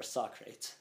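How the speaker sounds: two-syllable "sock-rates" for Socrates; misread Socrates. Shown as a demonstration. Offering another wrong reading, mispronounced. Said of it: "Or Socrates".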